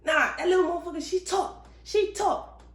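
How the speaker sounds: high, exasperated insisting; mock frustrated outburst. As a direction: insist she talks, fed up. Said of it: "I was frustrated too".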